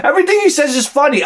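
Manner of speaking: usual speaking voice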